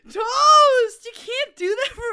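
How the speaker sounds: loud outraged yell; outraged shout. Real, not acted. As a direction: shout his name in protest. Shouting at friend.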